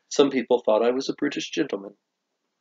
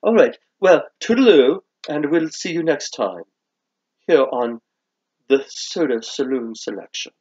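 put-on British accent. Host signs off the soda show, British accent. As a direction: bid farewell as a cheery Brit. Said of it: "how they would mock my British accent".

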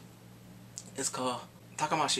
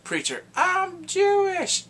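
comic character quote; 13 semitones higher, 7 dB louder, much slower than usual. Retold or imitated. Acted quote, character line.

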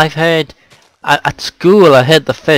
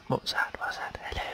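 whispering; 17 dB quieter, faster than usual. Real, not acted. Hearing a noise in game.

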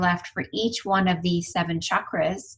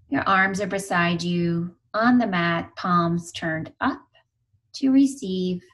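guided meditation. Real, not acted. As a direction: guide them unhurriedly into stillness, letting each phrase settle.

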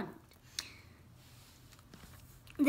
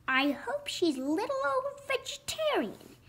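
high, softened runt voice; small turkey's high-pitched hoping; little runt; 7 semitones higher, 4 dB quieter than usual. A narrator's reading. Runt hopes the lady eats no meat, high voice. Runt's voice in story.

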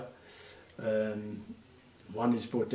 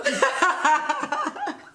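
high laughter; 7 dB louder than usual. Real, not acted. Laughing at the story.

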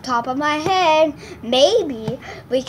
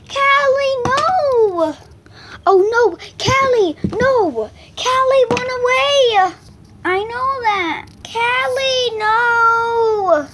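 calling out; 7 semitones higher, 4 dB louder, slower than usual. Pretend. Calling runaway dog.

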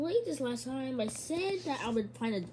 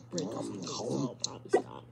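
whisper; 10 semitones lower than usual. Real, not acted. Aside while playing.

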